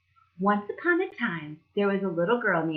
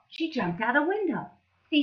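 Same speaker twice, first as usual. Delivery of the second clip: plain reading voice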